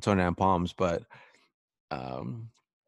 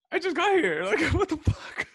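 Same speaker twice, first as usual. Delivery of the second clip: much higher, louder, laughing disbelief; incredulous protest; 5 dB louder than usual. Retold or imitated. Telling the corpsman he just arrived, high laughing disbelief.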